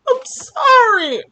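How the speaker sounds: high, sobbing plea through tears; crying voice. Shown as a demonstration. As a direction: break into tears on the apology. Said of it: "Let me try using my crying voice".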